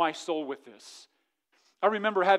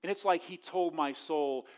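ordinary talk

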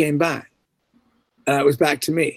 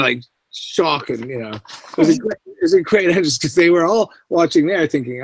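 ordinary delivery

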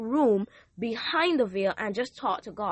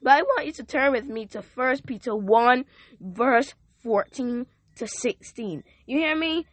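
rising, louder preacher's call to the congregation; full-force preaching; 4 semitones higher, 5 dB louder than usual. Acted. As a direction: preach it out, lifting the room.

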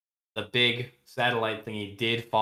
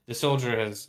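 ordinary delivery

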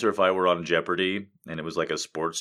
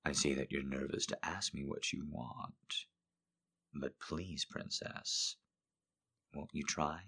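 much softer, low coaxing voice; sultry hush; 5 semitones lower, 14 dB quieter, slower than usual. Acted. Streamer voicing a coaxing lover, soft and low. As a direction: coax a nervous lover gently. Reads smutty quotes aloud.

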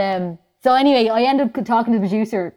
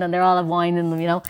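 ordinary talk

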